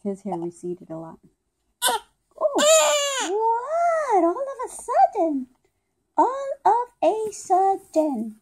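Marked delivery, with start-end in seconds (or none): 1.8-8.4 high, loud startled exclaim; sudden shock at the cry; surprised. Real, not acted.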